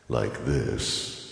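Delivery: low voice through an effect. Shown as a demonstration. Showing listeners the added effects, low effected voice. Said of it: "you can add effects".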